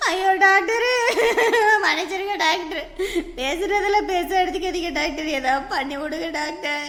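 tearful, wailing apology; whimpering plea. Acted. Patient begging the doctor for help, whimpering. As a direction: beg through sobs, dragging the last words. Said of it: "சரி, சரி, கத்தாம இருமா".

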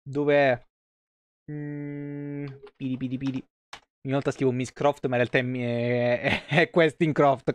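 ordinary talk, nothing marked

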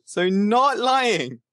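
high, slow, drawn-out protest; mock innocent. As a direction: play innocent, stretching it. Jokey insistence, high and slow.

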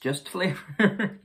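high, slow giggly pun; laughing at own joke. Real, not acted. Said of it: "I crack myself up".

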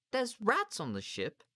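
put-on very high, slow child voice; high-pitched worried query; worried small boy. A narrator's reading. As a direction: ask it like an anxious little boy. Small boy worried about rats.

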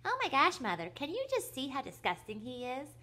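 very high, mocking squeak, loud at the start; high squeaky mimicry. A narrator's reading. Brother mimics his sister to mother, shrill squeaky voice. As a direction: push the mimicry right up into a squeak. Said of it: "mimicked Philip in a high, squeaky voice".

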